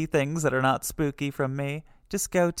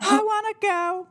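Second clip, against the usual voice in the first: very high, tiny ghost squeak; little high-pitched ghostly peep. Acted. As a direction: reveal it in a teeny spirit voice. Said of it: "what this ghost says".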